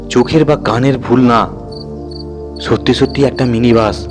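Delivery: plain reading voice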